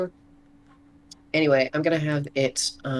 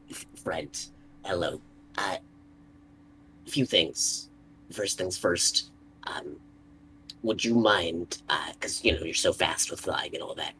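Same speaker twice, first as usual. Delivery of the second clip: tender; 8 semitones lower, 3 dB quieter than usual. Acted. Talking to the summoned snake.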